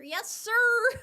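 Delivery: loud, high-pitched yes-sir; exaggerated, playful shouted response; performed on request. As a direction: belt it out high, stretching the sir. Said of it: "Can you say yes, sir?".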